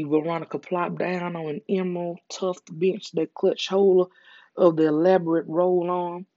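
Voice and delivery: usual speaking voice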